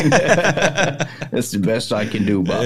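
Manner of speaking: a laughing Down East haggler; Maine accent. Retold or imitated. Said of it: "You really good at that".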